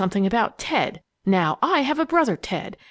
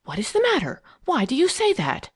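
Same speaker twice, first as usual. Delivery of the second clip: mildly fierce, voiced demand; fierce whisper; 8 semitones higher than usual. A narrator's reading. Phyllis questioning Eileen's warning, a touch of fierceness. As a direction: demand it sharply, pitch climbing. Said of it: "she demanded in a fierce whisper".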